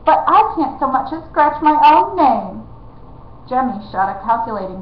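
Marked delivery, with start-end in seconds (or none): 0.1-2.6 high, loud protest; a spoiled royal wailing; whining prince. Acted.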